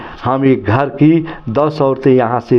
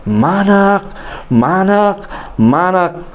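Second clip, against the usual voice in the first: high-pitched, amazed call of a name; astonished calling; 6 semitones higher, slower than usual. A narrator's reading. Mother calling her son Manak in astonishment. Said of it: "वो आश्चर्य से बिस्मित बोलती".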